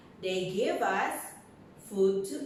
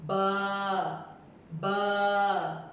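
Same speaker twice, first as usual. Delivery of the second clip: long, held "baa" on a level note; sheep bleat. Pretend. Teacher voicing a sheep for young learners, drawn-out baa. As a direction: draw the word out like a bleating sheep.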